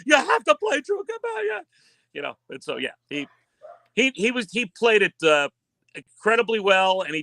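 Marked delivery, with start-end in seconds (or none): 0.0-1.6 mock fan shout. Women in the audience demanding their wedding song, high mock shout. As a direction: shout it like a crowd of fans. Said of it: "three hundred women immediately stood up and were like".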